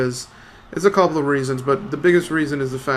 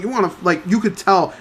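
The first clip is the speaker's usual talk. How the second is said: normal talking voice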